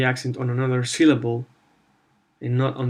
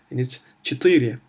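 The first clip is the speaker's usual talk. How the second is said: ordinary delivery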